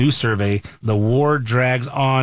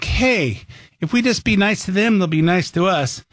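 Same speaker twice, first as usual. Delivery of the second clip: a lightly voiced naive optimist, higher; mock naive voice; 7 semitones higher than usual. Host mocks those who think kindness works, mock naive. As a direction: mimic the gullible dove mildly. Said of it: "when you think".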